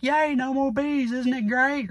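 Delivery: mock-innocent supporter celebrating; gleeful supporter cheer. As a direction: chirp it like a delighted simpleton. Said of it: "They're like".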